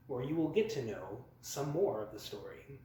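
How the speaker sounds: usual speaking voice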